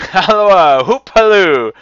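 very loud, high holler; whooping halloo. A narrator's reading. Scrooge calling after Friday, in a wild whoop. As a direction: whoop it out with abandon.

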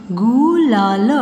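गुलाल drawn out sound by sound; syllable by syllable. Shown as a demonstration. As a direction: stretch each part of गुलाल. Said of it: "sound pronounce करके देख लीजिए". (गुलाल को तोड़-तोड़ कर)

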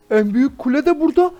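ordinary delivery

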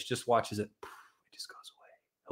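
hushed whisper as the problem disappears. Acted. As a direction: drop to a soft, breathy whisper as it fades. Dramatic hush on vanishing trigger.

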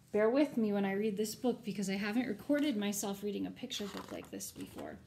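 usual speaking voice

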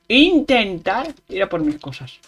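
Intentar leaned on with a falling push; stress on intentar. Shown as a demonstration. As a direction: punch the word Intentar. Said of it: "Recalco la palabra intentar".

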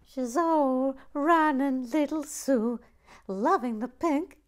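high, loud, gushing over the tiny figure; gushing delight. Real, not acted. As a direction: squeal it out like a delighted fan. Gushing over the pink minifigure.

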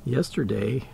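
normal talking voice

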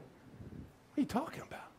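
whispered confusion; puzzled whisper. Retold or imitated. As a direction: whisper it, baffled. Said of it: "y'all be looking at me like".

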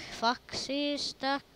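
P=A. Real, not acted.

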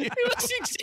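laughing. Real, not acted. Friend's confusion cracks everyone up.

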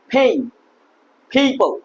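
ফেইম and people with a puff on the first p; aspirated p demo. Shown as a demonstration. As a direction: breathe out hard starting ফেইম, people. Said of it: "like this এভাবে উচ্চারণ করতে হবে".

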